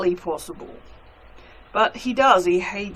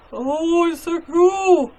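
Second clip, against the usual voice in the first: delighted coo; 8 semitones higher, 5 dB louder, slower than usual. Real, not acted. Gushing over glittery soap.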